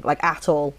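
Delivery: ordinary delivery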